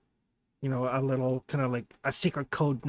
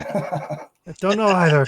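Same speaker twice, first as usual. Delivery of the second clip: louder, higher, through a laugh; 4 semitones higher, 8 dB louder than usual. Real, not acted. Game master joins the joke about Biz talking, laughing. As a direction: let the laugh carry the words.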